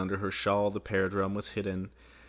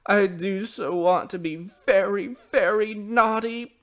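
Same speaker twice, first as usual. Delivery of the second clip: sobbing bitterly; 10 semitones higher, 7 dB louder than usual. A narrator's reading. Child confesses to mother, sobbing bitterly. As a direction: wail like a distraught child. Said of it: "sobbed more and more bitterly".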